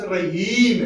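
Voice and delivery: रहीम with a long stretched ee; long vowel. Shown as a demonstration. Teacher demonstrating the long vowel sign, stretched vowel. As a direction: lengthen the second syllable of रहीम. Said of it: "जिससे बड़ी की मात्रा".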